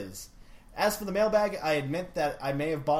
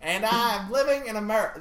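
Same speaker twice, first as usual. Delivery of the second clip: higher, put-on southern accent; 5 semitones higher, 4 dB louder than usual. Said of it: "do this in a southern accent".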